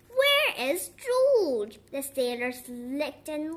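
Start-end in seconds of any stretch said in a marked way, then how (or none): 0.1-1.8 loud, high, drawn-out call; storybook cry of concern, pitched high; searching call. A narrator's reading. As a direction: call out slowly for someone missing. Sailors searching for missing George.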